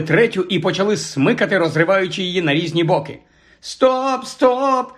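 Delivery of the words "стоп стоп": high-pitched cry to halt; shouting. A narrator's reading. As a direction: shopkeeper crying out in alarm. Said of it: "закричав продавець".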